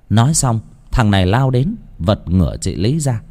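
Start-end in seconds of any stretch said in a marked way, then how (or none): none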